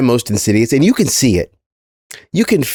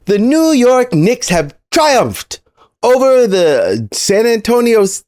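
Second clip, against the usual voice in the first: raised pitch, drawn-out arena proclamation; mock grand proclamation; 6 semitones higher, slower than usual. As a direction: proclaim it slowly like a mock-triumphant herald. Opens with mock grand triumphal proclamation.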